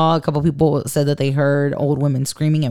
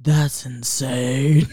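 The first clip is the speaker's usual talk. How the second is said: Zaddy; 5 semitones lower, 3 dB quieter, much slower than usual. Retold or imitated. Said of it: "He fucking says it".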